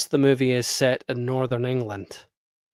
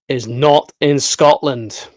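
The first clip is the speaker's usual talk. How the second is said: loud, slow, hard stress on "not"; emphatic correction; 2 semitones higher, 8 dB louder, slower than usual. Real, not acted. Vlogger correcting YouTubers about Holy Island, emphatic. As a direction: spell it out slowly and loudly: NOT in Scotland.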